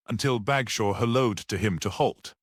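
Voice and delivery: plain reading voice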